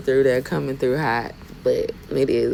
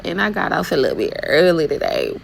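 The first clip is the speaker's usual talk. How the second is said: ordinary talk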